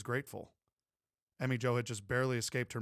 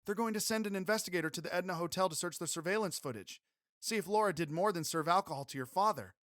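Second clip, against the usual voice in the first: put-on higher young woman's voice; Emmy Jo voice; 7 semitones higher, 5 dB louder than usual. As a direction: raise the pitch for the heroine.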